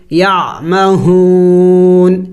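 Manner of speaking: یعمہون drawn out very slowly, the final nun held silent with only its nasal sound; stopping on the word. Shown as a demonstration. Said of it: "وقف میں ہم نے اس نون کو silent کرنا". (یعمہون کو آہستہ کھینچ کر ساکن نون پر وقف)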